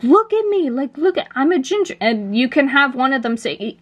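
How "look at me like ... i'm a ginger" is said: much higher, gleeful squeals; bursting with joy at being ginger; excited. Acted. New Doctor shows off to everyone, high and thrilled. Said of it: "he's just so excited".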